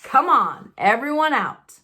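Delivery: loud barked command; bossy order. Acted. Uncle Vernon ordering the family out of the car, loud. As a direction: herd them out of the car.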